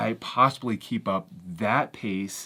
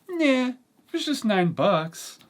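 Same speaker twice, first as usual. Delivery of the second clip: nonchalant shrug; 14 semitones higher than usual. Retold or imitated. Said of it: "all nonchalant".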